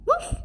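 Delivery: high, short, loud woof; dog bark. A narrator's reading. Bewitched girl can only bark, high woof. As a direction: yip like a little dog. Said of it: "she barked".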